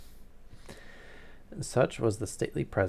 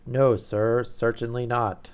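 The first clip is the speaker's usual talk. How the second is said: a louder, booming nobleman; booming Duke; 3 semitones higher, 8 dB louder, slower than usual. Acted. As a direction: let the Duke's words boom out like a dinner gong. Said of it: "I was already using my deep voice".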